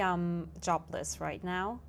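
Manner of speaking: ordinary talk, nothing marked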